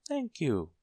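high, small character voice; tiny Mister Small. A narrator's reading. Tiny man wondering how to reach the bell, high voice. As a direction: pitch up high for the little man.

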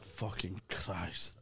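internal monologue. Acted. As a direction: draw it out quietly, as if thinking aloud. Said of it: "my internal monologue's taken over".

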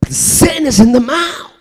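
loud, rising sermon cry; fiery preaching. Acted. Preacher crying out to the congregation, fiery. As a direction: preach it out with full force.

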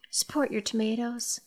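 soft unvoiced whisper. Real, not acted. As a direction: breathe it like a secret. Whispered aside to the listener.

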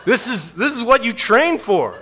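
loud, high exasperated inner voice; hammed-up yell at yourself; self-scolding. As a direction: berate yourself in mock outrage. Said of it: "And internally, I'm like".

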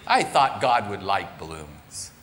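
preacher voicing a sad little boy about his balloon; hurt little boy. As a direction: voice the wounded four-year-old, lip quivering. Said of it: "his lip quivering".

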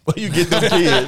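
laughing while echoing the line; laughing repeat. Retold or imitated. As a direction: echo it through a laugh.

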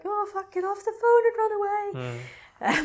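hammed-up panicked plea, stretched out; mock panic. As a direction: wail a warning at the screen. Viewers urging her to flee.

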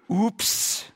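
comic "oops" in an odd voice, rising; hammed-up little oops; mock oops. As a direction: play the slip for a laugh. Mock alarm at a slip.

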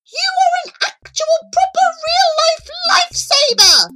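very high, loud exclamation; squealing, elated praise; overjoyed. Acted. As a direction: gush with relief at being rescued. Charlie overjoyed at being saved.

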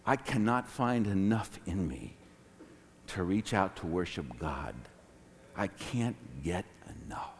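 very low, hushed confession; overwhelmed confiding. Retold or imitated. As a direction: confide it softly to a best friend. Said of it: "I said to one of my best friends".

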